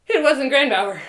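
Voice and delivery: relieved exclamation, held in check; dawning relief. Acted. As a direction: say it out as it sinks in. Relief on learning, first take.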